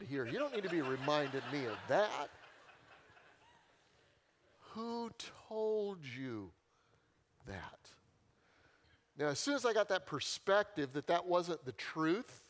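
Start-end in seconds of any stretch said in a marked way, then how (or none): 4.7-7.9 slow, drawn-out pointed question; slow emphatic repeat. Shown as a demonstration. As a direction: let each word sink in slowly. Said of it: "repeats her line slowly for effect".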